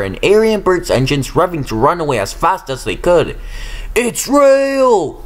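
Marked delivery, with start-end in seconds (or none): 4.0-5.2 terrified holler. A narrator's reading. Diesels hollering together that the ghost is real. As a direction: holler it in horror. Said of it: "they hollered together".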